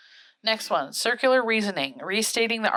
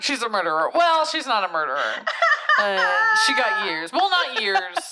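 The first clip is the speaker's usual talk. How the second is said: hammed-up, laughing rambling retelling; mock her muddled storytelling, laughing; imitating a rambling storyteller; 10 semitones higher, 4 dB louder than usual. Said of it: "You're telling a story like Travis right now".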